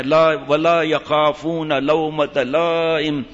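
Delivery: slow, drawn-out Arabic recitation; Quranic recitation. Real, not acted. Preacher quoting Allah's words to listeners, slow recitation. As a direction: recite it with long, measured vowels.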